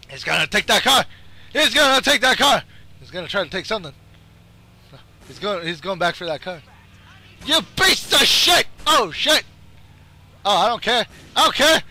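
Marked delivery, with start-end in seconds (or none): 1.5-2.6 high, loud warning; alarmed yell. Real, not acted. Gamer seeing his target reach a car, alarmed yell. 7.5-9.4 high, loud outburst; angry cursing. Real, not acted. Gamer cursing the escaping enemy, angry yell. 11.4-11.8 high, loud burst; defiant yell. Real, not acted. Gamer set on blowing up his opponent, defiant yell. Repeats louder and higher.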